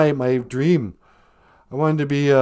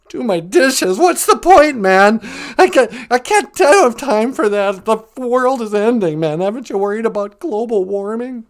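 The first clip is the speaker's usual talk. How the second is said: louder, higher panicky complaint; Cowardly Lion caller; 4 semitones higher, 4 dB louder than usual. Acted. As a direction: protest frantically like a scared lion.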